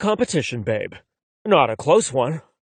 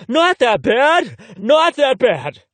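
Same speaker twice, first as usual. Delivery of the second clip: higher, louder, slow outraged repeat; indignant echo; 8 semitones higher, 5 dB louder, slower than usual. Acted. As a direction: throw his words back at him, outraged. Indignant echo of friend's words.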